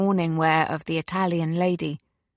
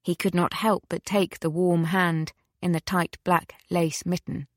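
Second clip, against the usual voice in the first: plain reading voice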